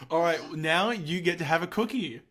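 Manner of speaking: normal talking voice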